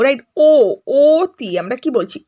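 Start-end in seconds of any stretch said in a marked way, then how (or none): 0.4-1.5 "অতি" said slowly as oh-ti; rounded "o" vowel. Shown as a demonstration. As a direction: sound out a long close o, then "অতি". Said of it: "অ ধ্বনিটির উচ্চারণ ও ধ্বনির মতো". ("অতি"-র অ টেনে ও ধ্বনিতে)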